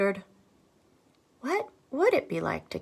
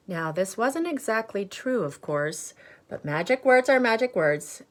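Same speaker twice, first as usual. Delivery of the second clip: ordinary delivery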